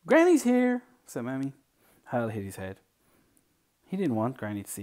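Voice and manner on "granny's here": high, loud announcement to the house; calling out. Acted. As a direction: sing out a visitor's arrival. Mammy calls out an arrival.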